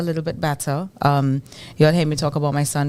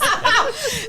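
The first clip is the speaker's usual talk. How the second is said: high laugh. Real, not acted. Laughs at sniffles remark.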